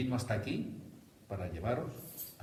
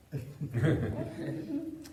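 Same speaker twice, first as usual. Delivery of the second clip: chuckle; 6 semitones higher than usual. Real, not acted. Teasing the audience, laughing.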